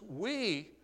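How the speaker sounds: We lifted high and stretched, with a pause after it; stress on We. Shown as a demonstration. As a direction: lean hard on We before going on. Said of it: "that word "we" is emphatic".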